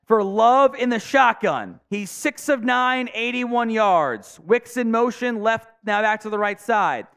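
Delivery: rising play-by-play, peaking on caught; live play-by-play. Acted. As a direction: call it like the broadcast booth.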